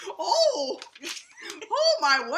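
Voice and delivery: high, loud swooning gasp; mock swoon. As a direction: overcome by a love letter, hammed-up. Mock swooning at love letter.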